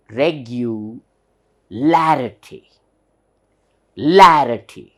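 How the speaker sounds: "Larity" with a punched first syllable; teacher demonstrating a strong "LA" in "Regularity"; stress on LA. Shown as a demonstration. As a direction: hit the "la" in "larity" hard and loud. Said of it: "should be pronounced hard".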